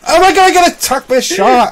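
high, loud panicked shout; panicked yell. Real, not acted. Player yelling to teammate about a shark, panicked. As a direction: yell in alarm as the shark comes.

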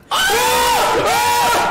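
sudden terrified scream. Real, not acted. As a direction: shriek at the jump scare. Jump scare screams.

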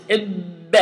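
إب with a bouncing b; ba sound demo. Shown as a demonstration. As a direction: stop hard on the b in إب. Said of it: "it is like this".

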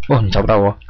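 ordinary talk

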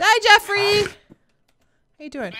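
high, loud bright hi; sing-song hello to a villager; cheery greeting. Real, not acted. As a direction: call out cheerfully to a friend.

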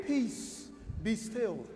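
soft gentle quote. Retold or imitated. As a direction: hush it like a mild plea. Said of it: "He didn't say".

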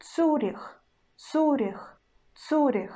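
Zurich as Цюрих with hard ц and у; Russian demo word. Shown as a demonstration. Teacher demonstrates Zurich to students, hard ц.

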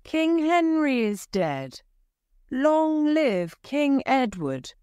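high proclamation to the hall; proclaiming cry. A narrator's reading. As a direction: announce the king to all. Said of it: "cried out to the people".

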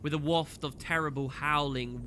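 ordinary talk

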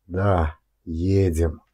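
plain reading voice

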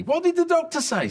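very high, loud strained squeal; agonised victim. Acted. Bitten camper in agony asking his friend, squealing. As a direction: squeak it out in pain.

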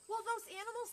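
plain reading voice

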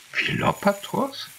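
high, slow, rising disbelief; astonished question. A narrator's reading. Agathon hears who sent Krates, high slow astonished echo. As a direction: echo the name as if you can't believe it.

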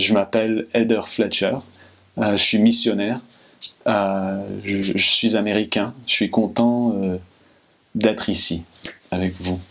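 French in an authentic French accent, back-of-mouth R's; native French accent. Shown as a demonstration. Coach showing missionaries how French people say it, native French accent. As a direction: introduce yourself like a native French speaker. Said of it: "the way a French person would say it".

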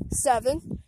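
ordinary talk

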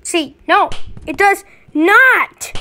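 normal talking voice

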